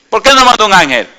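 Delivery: high, loud, emphatic question; preaching climax. Real, not acted. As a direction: hit the question at full force. (pregunta predicada con fuerza)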